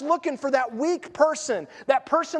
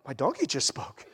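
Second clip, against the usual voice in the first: stunned realization; 11 semitones lower, 6 dB quieter than usual. Retold or imitated. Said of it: "and go".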